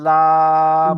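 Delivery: লাম loud, with a long held vowel; loud drawn-out model of লাম; articulation demo. Shown as a demonstration. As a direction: project লাম on one level, stretched note. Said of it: "লাগিয়ে উচ্চারণ হয়".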